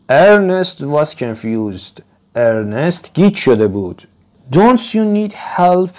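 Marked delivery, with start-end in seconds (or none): 4.5-5.9 high, slow worried asking; a storybook voice, lifted high with concern; concerned question. A narrator's reading. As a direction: ask as if truly worried for him. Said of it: "He asked".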